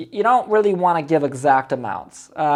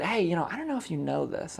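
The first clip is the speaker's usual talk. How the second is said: softer, confiding tone; dropped voice; 10 dB quieter than usual. Shown as a demonstration. Coach repeating his line to the seller, voice dropped softer. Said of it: "and I dropped my voice a little bit".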